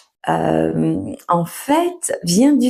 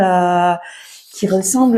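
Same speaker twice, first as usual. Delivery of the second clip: usual speaking voice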